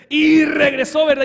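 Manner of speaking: ordinary delivery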